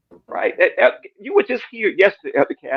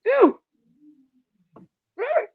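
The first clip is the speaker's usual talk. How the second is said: incredulous. Retold or imitated. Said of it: "I'm like".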